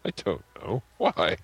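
usual speaking voice

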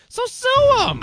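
high squeal. Acted. High exclamation.

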